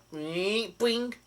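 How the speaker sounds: springy boing sliding upward, ending high; imitated boing. Describing a claw attack rebounding, imitated springy sound effect. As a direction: voice a cartoon bounce noise.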